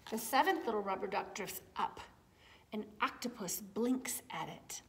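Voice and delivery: gentle storybook narration, lots of tune; reading to children. A narrator's reading. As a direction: read it like bedtime to little ones. Reading a picture book aloud.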